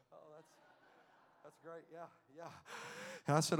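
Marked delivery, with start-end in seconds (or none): 0.1-2.5 mock unimpressed. Mocking unimpressed congregation.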